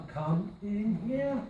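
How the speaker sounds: slow, drawn out "come in here"; slow tune check. Shown as a demonstration. Teacher slowly testing the tune of the phrase. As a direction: stretch it out to hear the tune. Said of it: "I don't think it really does go up".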